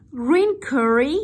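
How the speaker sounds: high, loud "Green curry?" rising on "curry"; rising intonation. Shown as a demonstration. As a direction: query it, lifting "curry" at the end. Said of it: "Rising intonation". (giọng cao, to, lên ở "curry")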